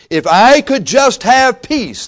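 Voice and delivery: high, slow, yearning wish; longing plea. Retold or imitated. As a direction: plead for peace, drawn out. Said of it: "they would say".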